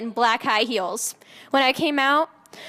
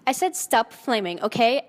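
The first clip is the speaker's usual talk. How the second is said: usual speaking voice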